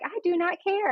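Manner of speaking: high, insistent, word-by-word refusal; firm doctor. Retold or imitated. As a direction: lay down the law with a mock-stern tone.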